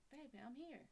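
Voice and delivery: calm her with a soft voice; soft reply. Shown as a demonstration. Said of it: "talking like this".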